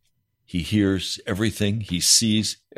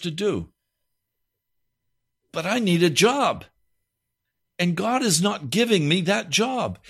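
higher pitched frustrated complaint; angry caller; 8 semitones higher than usual. Retold or imitated. Pastor imitating an angry listener venting about God. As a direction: vent like a man fed up with waiting. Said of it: "he said to me".

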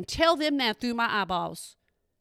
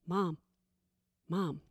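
put-on hushed kids' plea; 4 semitones lower, 6 dB quieter, slower than usual. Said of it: "They give me the side eye like".

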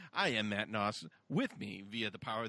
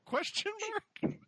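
questioning rise. Retold or imitated. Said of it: "One in the Scarecrow?".

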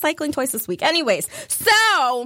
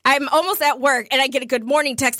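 ordinary talk, nothing marked

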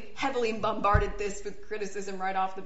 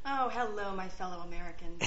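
presidential voice; 2 semitones lower, 5 dB quieter than usual. Acted. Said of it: "I feel like Jon Voight".

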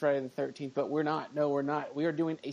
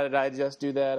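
ordinary talk, nothing marked

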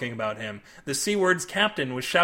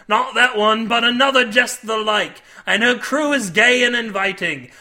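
loud, higher sailor's yarn, settling toward the end; intense old-salt storyteller; 4 semitones higher, 5 dB louder than usual. A narrator's reading. Milby warning Cade about the devil ship, fervent. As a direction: tell it as a dire warning. Milby tells his sea tale.

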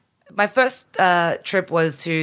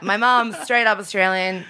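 ordinary delivery

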